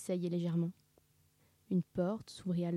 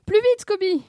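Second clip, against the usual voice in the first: loud, high-pitched yell; panicked yell; 13 semitones higher, 13 dB louder than usual. A narrator's reading. Sammy urges Scooby to flee, yelling in panic. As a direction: yell it to hurry him on. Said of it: "hurla Sammy".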